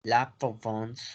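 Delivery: French Provence, softly modelled; French pronunciation. Shown as a demonstration. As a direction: repeat La Provence slowly as "pro-vons". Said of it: "La Provenza es La Provence".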